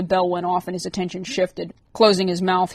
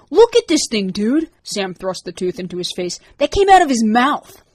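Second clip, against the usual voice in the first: higher, louder gleeful excitement; excited show-off; 4 semitones higher, 6 dB louder than usual. A narrator's reading. Sam excitedly showing Matt the broken tooth. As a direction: shove it in his face, thrilled.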